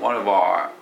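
one of our run together slowly so it sounds like "wa-va"; linked our. Shown as a demonstration. Teacher showing how one of our links, slowly. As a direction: blur one of our into one smooth "varva". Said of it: "varってなりますね".

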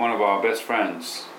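one of our best friends, slow, our swallowed to a quick "a"; reduced our. Shown as a demonstration. Teacher demonstrating the weak our to class, slowly. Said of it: "これほとんど発音しないですね".

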